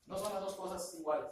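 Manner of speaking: ordinary delivery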